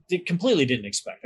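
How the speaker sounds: ordinary delivery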